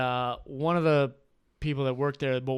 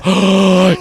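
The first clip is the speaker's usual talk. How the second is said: loud mock gasp; shocked group gasp; 4 semitones higher, 14 dB louder than usual. Whole table reacts to the word, mock-horrified gasp. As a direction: gasp in exaggerated horror. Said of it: "And they all go".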